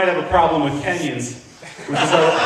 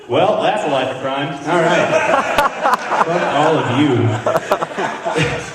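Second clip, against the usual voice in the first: bitter supervillain vow; slower than usual. Retold or imitated. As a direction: slowly turn evil and curse everyone. Kid turning supervillain.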